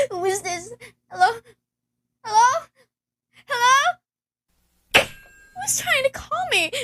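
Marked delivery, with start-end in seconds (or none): 2.2-6.7 desperate, frightened pleading; rising panic. Acted. Invalid woman begging an unknown caller, rising panic.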